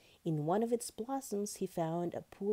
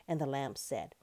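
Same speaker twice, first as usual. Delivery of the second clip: ordinary delivery, nothing marked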